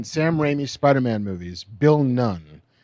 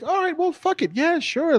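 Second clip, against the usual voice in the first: mock nonchalant. Mock-casual self quote.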